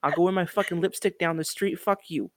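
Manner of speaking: loud, blustering mock New Yorker. Guest acts defiant about lipstick on the street, New Yorker accent. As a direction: rant like a hammed-up New Yorker. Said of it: "that very New Yorker".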